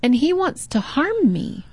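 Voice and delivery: put-on high, hurried kid telling on someone; upset little boy. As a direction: run in and tell mom. Scared boy runs to mom.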